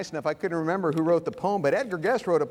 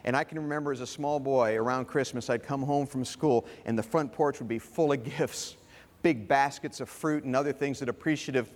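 ordinary talk, nothing marked

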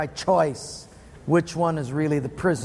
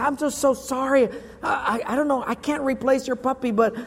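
higher-pitched, hesitant apologizing; acted apology; 6 semitones higher than usual. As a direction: fumble a sorry as the guilty driver. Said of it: "I say".

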